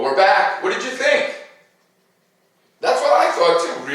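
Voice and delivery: ordinary delivery, nothing marked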